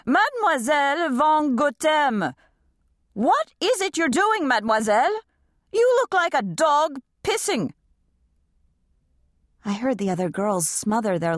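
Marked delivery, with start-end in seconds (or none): 0.0-7.7 harsh, high shouting ballet mistress; yelling across the room. A narrator's reading. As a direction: bark it across the room, much higher. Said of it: "shouted across the room in her terrible, grating voice".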